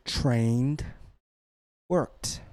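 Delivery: trained and worked each said as one syllable; one-syllable ed words. Shown as a demonstration. Teacher showing students a pattern, one-syllable ed words. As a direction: say "trained" and "worked" singly, with no extra ed beat. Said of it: "So trained is one syllable".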